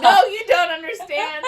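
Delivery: high, loud mock outburst; upset protest. Man nobody believes insists to them, upset. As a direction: protest hard, voice shooting up. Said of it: "he gets super upset".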